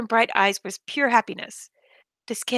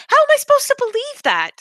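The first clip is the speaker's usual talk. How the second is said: much higher, louder exasperation; frantic disbelief; 6 dB louder than usual. Acted. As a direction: throw up your hands. Protagonist overwhelmed.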